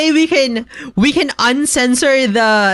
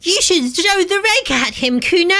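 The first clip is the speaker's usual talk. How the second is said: imitating an anime girl voice; hammed-up anime kid line; overacted anime dub; 6 semitones higher than usual. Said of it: "She sounds like an anime character".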